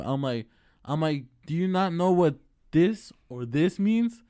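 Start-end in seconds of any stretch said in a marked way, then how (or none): 1.5-4.2 slow, loud, incredulous question; exasperated. Retold or imitated. Worker challenging the manager over nodding, exasperated. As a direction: spell it out with mounting exasperation.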